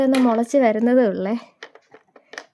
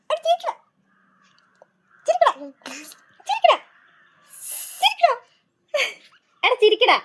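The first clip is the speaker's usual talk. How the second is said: very high sing-song coaxing; 10 semitones higher, faster than usual. Real, not acted. Mother coaxing her baby to smile, squealing high.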